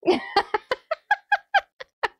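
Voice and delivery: very high, squeaky laugh; helpless laughter. Real, not acted. Streamer loving a silly message, helpless laughter. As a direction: laugh high until it peters out.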